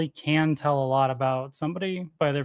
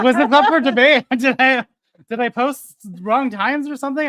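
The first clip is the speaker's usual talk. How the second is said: incredulous; 8 semitones higher, 7 dB louder than usual. Retold or imitated. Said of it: "And I'm like".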